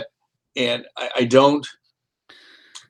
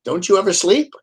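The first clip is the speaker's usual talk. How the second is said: lightly put-on impersonation, pitched up; horrible Richard Dreyfuss; 6 semitones higher than usual. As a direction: badly imitate the caller with a higher, questioning voice. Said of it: "I do a horrible Richard Dreyfuss voice".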